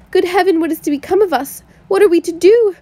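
high, loud, woeful cries; bitter woe. A narrator's reading. Sisters lamenting the regiment leaving, high woeful outcry. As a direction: wail it out in bitter misery. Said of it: "in the bitterness of woe".

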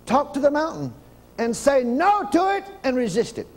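preacher at full force; full-force preaching. Acted. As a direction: preach it hard to the crowd.

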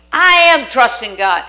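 loud, strained insistence; insistent declaration. Retold or imitated. Sick believer insisting to herself, loud and strained. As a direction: force yourself to believe it out loud. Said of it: "you think".

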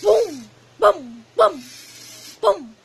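shouted boom sounds. Real, not acted. Imitating gun sounds playing.

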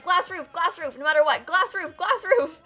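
high, loud chanting mimicry of fans; mocking fans. Streamer mocking viewers begging for glass roofs, high loud chant. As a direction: parrot the viewers' nagging chant. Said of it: "everyone's always like".

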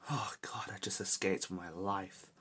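whispered gasp of relief; relieved whisper. Real, not acted. Gamer just escaped the owl, whispering in relief. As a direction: breathe it out barely voiced.

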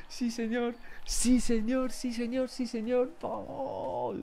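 real euphoric high-pitched cheering; wild celebrating. Real, not acted. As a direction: celebrate the pull like a goal. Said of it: "no puedo gritar".

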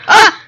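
high, loud yelp; startled yelp. Real, not acted. Gamer pricked by a cactus, loud high yelp.

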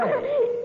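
quick, high burst of laughter; short laugh; short giggle. Real, not acted. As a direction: giggle before answering. Giggling at caller's flirting.

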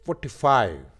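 Forty five, loud, both halves stressed; teacher stressing both words of forty five. Shown as a demonstration. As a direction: lean on forty and on five equally. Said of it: "forty पे भी stress है और five पे भी".

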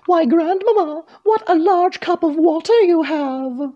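put-on high-pitched little girl voice; Little Red speaking up. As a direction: address Grandmamma in a high, storybook voice. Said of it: "and spoke loudly".

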